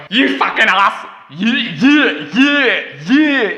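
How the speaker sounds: loud, mock Scottish rant at the friend; hammed-up Scottish yelling; a friend heckling in a loud mock Scottish accent. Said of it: "Wait, that's Scottish".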